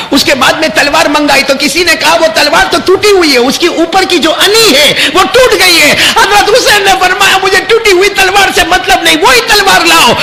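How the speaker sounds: high, loud wailing narration; lamenting sermon climax. Acted. Preacher wailing over Ali Asghar's thirst. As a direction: build the grief to a cry.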